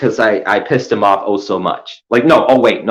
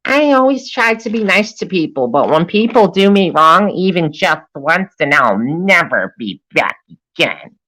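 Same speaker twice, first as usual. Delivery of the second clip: high mocking imitation of Ace; 8 semitones higher than usual. Mimicking Ace's words to mock her, high and whiny. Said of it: "quoting Ace in put-on voice".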